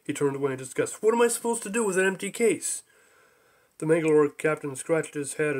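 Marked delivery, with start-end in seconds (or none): 1.0-2.8 angry Zorg. Acted.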